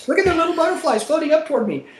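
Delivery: high, sing-song mock delight at the flak; sarcastic wide-eyed awe; mock wonder. Pilot mocking tracer fire at night, high mock wonder.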